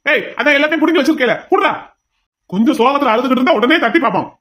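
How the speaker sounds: irritated argument; heated retort. Acted. Argues with officer.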